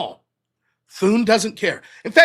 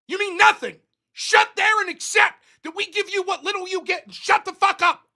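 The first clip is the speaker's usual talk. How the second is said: mock senator rant; 7 semitones higher than usual. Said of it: "And they're like".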